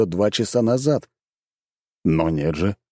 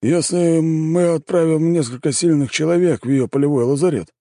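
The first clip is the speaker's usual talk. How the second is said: high-pitched adviser proposing a plan; scheming imperial adviser; 8 semitones higher than usual. A narrator's reading. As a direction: pitch the plan in a raised, emphatic voice. (голос советника, увлечённого замыслом)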